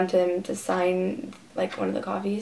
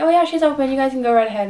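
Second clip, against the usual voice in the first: higher, louder, obliging guard imitation; imitated security guard; 5 semitones higher, 6 dB louder, faster than usual. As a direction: wave them through in a raised put-on voice. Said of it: "he's like".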